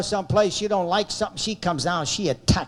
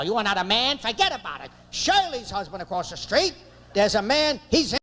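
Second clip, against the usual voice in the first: rapid-fire pitched-up tirade; nagging wife; 3 semitones higher than usual. Retold or imitated. Wife to husband who can't hang a picture, nagging. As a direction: imitate a wife berating her useless husband. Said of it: "Now she sounds like this".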